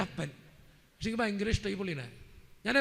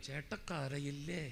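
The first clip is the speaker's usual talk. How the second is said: a much lower, softer, slow teasing voice; mock wife; 10 semitones lower, 9 dB quieter, much slower than usual. Wife teasing her husband about crying, low, soft and slow. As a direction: drawl it slowly, under the breath, as a mock-innocent wife.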